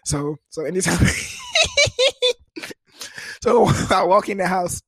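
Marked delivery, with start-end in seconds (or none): 1.1-2.3 squealing, high-pitched laugh; high giggle. Real, not acted. Storyteller laughing at his own habit, high giggle.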